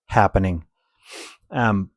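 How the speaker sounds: normal talking voice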